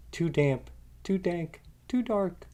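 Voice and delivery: high, squeaky little mouse voice. A narrator's reading. Tiny mouse complains about the cave, squeaking high. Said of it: "Mouse squeaks".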